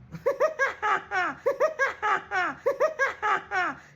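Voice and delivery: long, loud, high burst of laughter; rapid staccato high cackle; high-pitched laugh. Real, not acted. Laughs amid talk of shyness.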